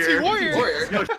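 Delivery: slow high drawn-out battle cry; imitating the soundboard battle cry, laughing; C T Warrior battle cry. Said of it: "hit the C T Warrior voice clip".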